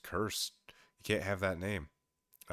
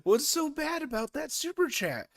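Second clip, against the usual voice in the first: high, loud disbelieving wait what; real alarm, voice jumping up and loud; shocked; 12 semitones higher, 10 dB louder than usual. Real, not acted. Streamer stunned by a viewer's super chat, high and loud.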